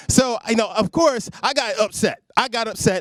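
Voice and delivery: ordinary talk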